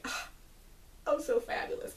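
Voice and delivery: airy high mock-sassy sigh; sassy fabulous. Grandmother Olenna showing off, sassy airy tone. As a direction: toss it off like a grande dame. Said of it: "being sassy fabulous as usual".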